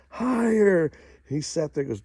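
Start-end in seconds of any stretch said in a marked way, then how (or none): 0.1-0.9 final higher, much higher and louder; the cheer peaks, punch it out; kids cheering louder. Retold or imitated. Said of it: "they all started cheering".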